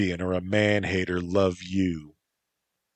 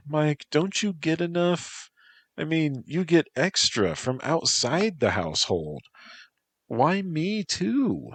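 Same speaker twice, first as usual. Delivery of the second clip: higher voice, gently pleading, slowing on the last question; Lilia, softened plea; 9 semitones higher than usual. Acted. Lilia asking Mike why he wants her too, higher and pleading.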